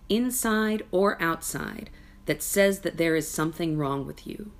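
ordinary talk

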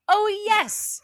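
eager princess. Acted. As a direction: squeal it like a spoiled girl. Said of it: "So of course she says".

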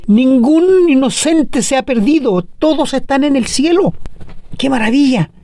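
preacher exulting that the innocent are in heaven; joyful exclamation. Real, not acted. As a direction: proclaim it as good news. Preacher rejoicing over the verse.

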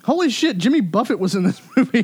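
high, loud amazed laughing; delighted disbelief, laughing; excited. Real, not acted. Amazed at cast discovery.

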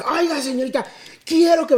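voz aguda y golpeada de vecino exigente; modito golpeado. Retold or imitated. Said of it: "tenía moditos golpeados este bro". (el vecino llamando a emergencias, con modito golpeado)

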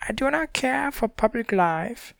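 high, prim little creature voice; Manticora voice. A narrator's reading. Manticora declining to leave the book, high prim voice. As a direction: sniff it out like a shy beast.

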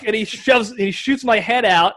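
ordinary talk, nothing marked

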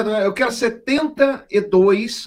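high, slow, drawn-out order; acted customer spelling out a big order; emphatic order. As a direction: stretch out the absurd number. Voices customer placing absurd order.

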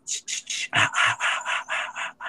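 whispered shushing and ah sounds. Retold or imitated. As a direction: hush someone in a whisper. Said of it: "He's like".

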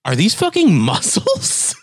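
high, slow, incredulous question; hammed-up shock; acted disbelief. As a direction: stretch it out, voice climbing in astonishment. Retold astonishment at hard back muscles.

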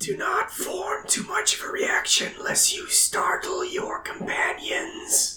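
breathy divine whisper; dramatic whisper. Acted. God speaks into a man's mind, dramatic whisper. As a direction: whisper it like a voice from the gods. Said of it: "a dramatic voice".

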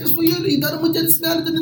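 very high broken Dutch; the delivery guy pushing, in broken Dutch. Retold or imitated. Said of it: "de meest gebroken taal". (heel hoog gebroken Nederlands)

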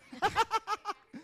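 sudden high laugh; high burst of laughter. Real, not acted. Laughs at grandpa joke.

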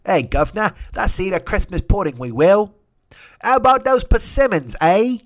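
put-on lighter old English accent, rising to high and loud; a second, milder go at the old English voice; mock old English. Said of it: "I don't know who's doing that voice".

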